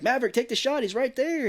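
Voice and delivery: high, loud retold plea; acted frantic co-pilot; urgent urging. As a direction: urge him to fire right now.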